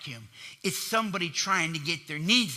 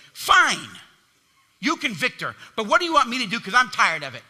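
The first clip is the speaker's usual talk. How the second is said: loud, higher, exasperated snap; frustrated outburst; 3 semitones higher, 7 dB louder than usual. Acted. Pastor voicing himself snapping at God in frustration. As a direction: throw up your hands and snap at him. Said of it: "And in my frustration".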